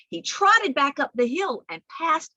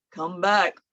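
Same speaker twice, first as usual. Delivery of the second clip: lower, rumbling call; 5 semitones lower, 3 dB louder than usual. Shown as a demonstration. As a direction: say it down low. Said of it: "one named Low".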